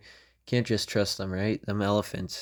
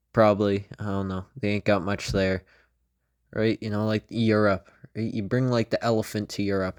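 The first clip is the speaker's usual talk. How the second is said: usual speaking voice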